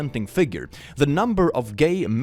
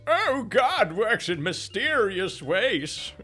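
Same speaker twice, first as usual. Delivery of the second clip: mock pious; 8 semitones higher, 3 dB quieter, much slower than usual. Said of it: "Anything else is".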